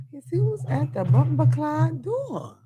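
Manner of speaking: put-on a light Jamaican accent. Joking to viewers about who's at the door, in a light Jamaican accent. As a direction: toss it off in a Jamaican lilt. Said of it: "I done gave you three accents in three minutes".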